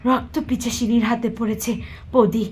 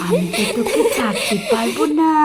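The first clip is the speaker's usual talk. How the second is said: vampire menace; 3 semitones higher than usual. Acted. Vampire menacing her captive. (ডাইনির ভয়ঙ্কর হুমকি)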